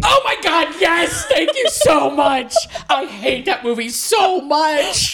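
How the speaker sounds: high, loud gleeful outburst; thrilled, loud, drawn-out exclamations; excited agreement. Real, not acted. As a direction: shriek with relief at finding an ally. Friend shares hatred of movie.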